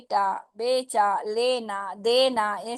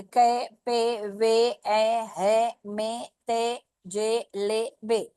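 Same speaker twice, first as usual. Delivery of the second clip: कै, पे each syllable stretched very slowly; slow syllable drill; much slower than usual. Shown as a demonstration. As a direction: sound out कै, पे one by one, slowly. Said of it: "अभी देखिए".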